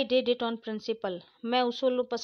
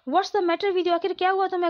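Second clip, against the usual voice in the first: alarmed question; 7 semitones higher, 4 dB louder than usual. Acted. Father alarmed at daughter.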